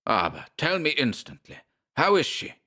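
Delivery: put-on urgent, a touch of German accent. Schmersholt demands news of Nancy, German accent. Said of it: "German oaths".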